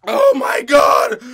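slow, loud, crying-out groan of pain; wailing from the spice; mouth burning from spicy food. Real, not acted. Pepper burn peaks.

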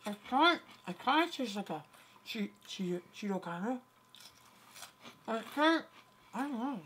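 talking through a mouthful; words round a bite of food; mouth full. Real, not acted. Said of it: "Don't talk with your mouth full".

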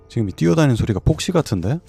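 usual speaking voice

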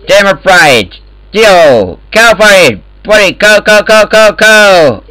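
ordinary talk, nothing marked

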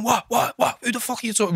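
high, repeated mock challenge; retold confrontation. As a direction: act out squaring up with rapid whats. Acts out the group squaring up.

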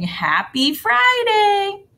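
high, loud, drawn-out cheer; excited cartoon exclamation. Teacher voices her cartoon cheering about Friday, excited. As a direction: stretch it out with big feeling. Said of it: "little cartoon over here saying".

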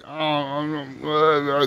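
put-on quiet, stumbling drunk mumble; can't get the words out, drunk; slurring words. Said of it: "he's all slurring his words".

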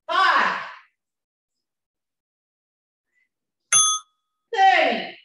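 loud counts called out hard; shouting. Real, not acted. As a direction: bellow the reps at full power. Shouting reps through set eight.

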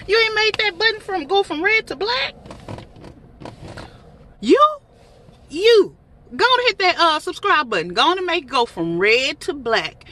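high, loud teasing scold; playful call-out. Real, not acted. Calling out non-subscribers.

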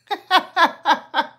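light self-conscious laugh; embarrassed laugh. Real, not acted. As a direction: chuckle at yourself. Laughs about son's hair job.

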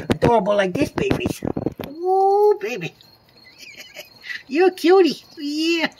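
high cooing with laughs. Real, not acted. Cooing at babies.

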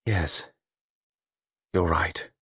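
soft, resigned murmur. A narrator's reading. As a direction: barely give in, under the breath. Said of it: "Pauline murmured".

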